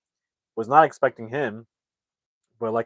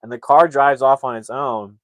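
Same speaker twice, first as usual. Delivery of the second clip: normal talking voice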